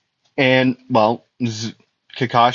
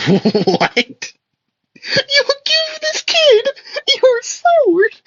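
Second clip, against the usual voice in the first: much higher, slower squeaking disbelief; shocked squeal; much slower than usual. Retold or imitated. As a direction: screech it like you can't believe your ears. Kakashi shocked at sword offer.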